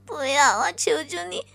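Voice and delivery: crying, desperate begging; sobbing plea. Acted. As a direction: plead through sobs. Girl begs him to stop.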